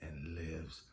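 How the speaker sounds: low, quiet voice with measured pauses; soft, deep pronouncement, unhurried at the close; God. Retold or imitated. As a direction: let each word land softly and low. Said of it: "And he said it again".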